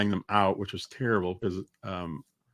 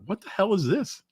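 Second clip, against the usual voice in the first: baffled; 9 semitones higher than usual. Retold or imitated. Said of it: "I was like".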